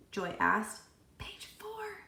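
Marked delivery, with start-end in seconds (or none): 1.2-2.0 hushed whisper in class; breathy classroom whisper. A narrator's reading. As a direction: whisper it so the teacher won't hear. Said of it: "Todd whispered".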